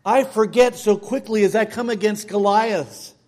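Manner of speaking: usual speaking voice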